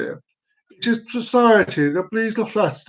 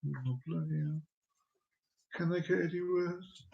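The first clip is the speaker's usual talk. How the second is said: hushed mock groan of despair; a barely voiced quiet complaint; imitating Roz; 18 dB quieter than usual. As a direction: mutter it like a fed-up partner. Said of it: "she sort of goes".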